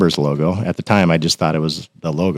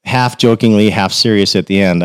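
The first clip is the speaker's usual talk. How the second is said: ordinary talk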